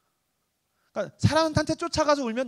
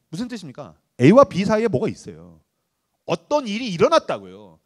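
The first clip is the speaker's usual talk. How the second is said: ordinary talk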